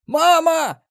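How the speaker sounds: very high, loud surprised scream; startled yell. A narrator's reading. Marauder set upon by attackers, startled high yell. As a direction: yell out in shock. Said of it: "удивлённо орал мародёр".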